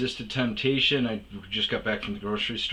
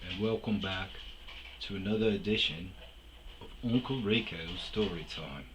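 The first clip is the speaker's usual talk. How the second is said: ordinary talk, nothing marked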